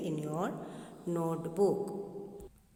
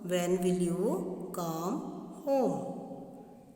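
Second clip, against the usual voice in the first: very slow, each word stretched; slow drawn question; much slower than usual. Shown as a demonstration. Teacher reading a question, very slowly. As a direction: drag the question out. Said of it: "This is correct".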